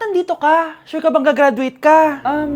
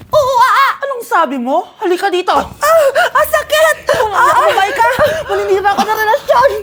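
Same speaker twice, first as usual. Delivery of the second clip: pained fight yells; 7 semitones higher than usual. Acted. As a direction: yelp as your hair gets pulled. Hair-pulling fight between classmates.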